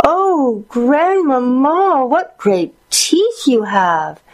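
put-on very high, drawn-out girlish squeal; stretched, high-pitched storybook exclamation. A narrator's reading. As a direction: squeal slowly in astonished alarm. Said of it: "she squealed".

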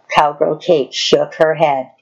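plain reading voice

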